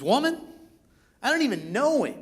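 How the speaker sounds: indignant denial. Acted. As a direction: act out a protesting Peter. Peter denies Jesus to servant girl.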